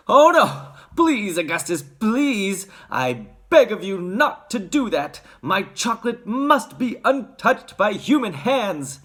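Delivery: alarmed, high-pitched plea; distressed outcry; crying out. A narrator's reading. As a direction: beg him urgently to stop. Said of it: "he cried out".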